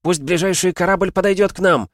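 very high, loud commanding yell; shouted orders. A narrator's reading. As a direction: bellow orders over the storm. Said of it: "крикнула она". (командный крик сквозь бурю)

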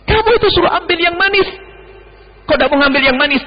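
high, scolding master's rebuke; angry master. Retold or imitated. Imam scolding his servant over sour grapes, angry and high. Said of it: "Marah dia".

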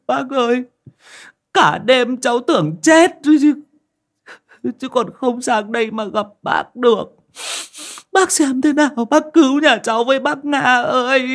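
high, loud wail broken by sobs; desperate crying plea; tearful pleading. A narrator's reading. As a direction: beg for rescue through tears. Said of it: "Chị nọ vừa khóc, mếu máo".

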